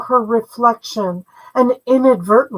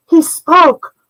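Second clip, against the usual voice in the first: choked with tears, pitched up and louder; crying; 6 semitones higher, 4 dB louder than usual. Acted. Brother overcome, crying as he finally speaks.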